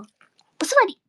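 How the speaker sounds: high, loud call of "座り"; dog command. Real, not acted. Telling the pet dog to sit, loud and high.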